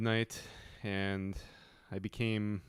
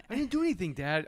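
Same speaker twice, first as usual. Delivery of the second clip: put-on high whiny kid voice; mock kid denying; 9 semitones higher than usual. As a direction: play the guilty kid protesting innocence.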